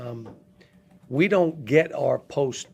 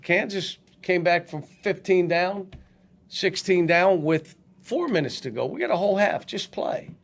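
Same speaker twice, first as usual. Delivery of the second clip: higher-pitched halftime rallying of the team; urging pep talk; 6 semitones higher than usual. Retold or imitated. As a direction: urge a losing team to keep playing.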